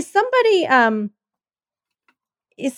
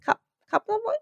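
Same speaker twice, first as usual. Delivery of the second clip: mock British accent; 11 semitones lower, 5 dB quieter than usual. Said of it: "That's what they say in England".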